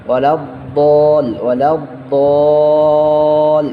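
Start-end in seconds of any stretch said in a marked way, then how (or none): 2.1-3.6 দল held for a long four-alif stretch; long madd. Shown as a demonstration. Teacher showing the four-alif stretch, drawn out. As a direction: sing out দল with a long drawn vowel. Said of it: "চার আলিফ টানের একটা কায়দা আছে".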